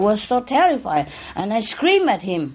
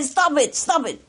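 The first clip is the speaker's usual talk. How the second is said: retold scream; 7 semitones higher than usual. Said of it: "I screamed at him".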